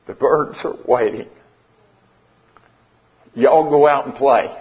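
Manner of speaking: measured tribute with long, weighty pauses; heartfelt farewell in verse; poem recitation. Real, not acted. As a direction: close the poem deliberately, line by line.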